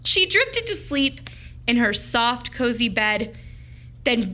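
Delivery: ordinary delivery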